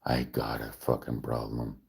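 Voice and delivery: quiet, low, deadpan narration; low narrator voice. Acted. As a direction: mutter it like a gloomy narrator. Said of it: "stuck in narrator mode".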